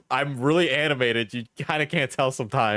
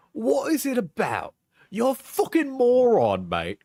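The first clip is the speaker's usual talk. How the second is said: higher-pitched mock British accent; put-on accent; 8 semitones higher than usual. Reading breakup texts back, high put-on British accent. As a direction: act the texts out in exaggerated British. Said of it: "when I'm doing the accent".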